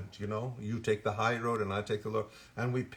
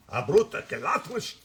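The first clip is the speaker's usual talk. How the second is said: higher, louder mock-Russian gibberish; 6 semitones higher, 6 dB louder than usual. Shown as a demonstration. Actor showing his improvisation gibberish, Russian accent. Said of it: "mine was always Russian".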